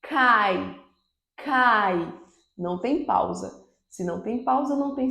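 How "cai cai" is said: "cai" said alone as one unbroken syllable; isolated syllable for the class; syllable demo. Shown as a demonstration. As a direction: show "cai" with no pause inside. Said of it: "quando eu digo".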